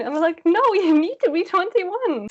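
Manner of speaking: mock exasperated server; exasperated correction. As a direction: spell it out to her. Correcting underage girl.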